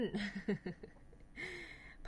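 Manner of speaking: quiet little laugh to oneself; soft chuckle. Real, not acted. Teacher laughs after repeating a word.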